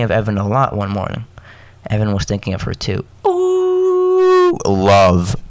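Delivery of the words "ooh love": high teasing "ooh", then loud "love"; playfully hammed-up lovey-dovey cooing; mock swoon. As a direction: tease about a crush. Joking swoon over the characters.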